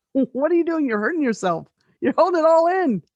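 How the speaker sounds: high-pitched, dismayed protest; mock alarmed scolding. People telling her she hurts herself sneezing, alarmed.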